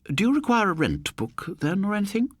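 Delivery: a prim spinster offering help, voiced high; elderly landlady voice. Landlady asks her new lodger, in a high prim voice. Miss Mercer voiced higher.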